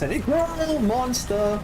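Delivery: high, amazed exclamation; excited. Real, not acted. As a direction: blurt it out in awe. Sees huge monster in game.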